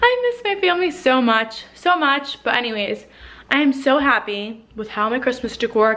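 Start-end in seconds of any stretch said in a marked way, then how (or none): 0.0-2.4 high, choked-up longing for family; emotional. Real, not acted. Vlogger missing family far away, getting emotional. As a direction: voice rising as the feeling wells up. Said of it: "I'm getting emotional".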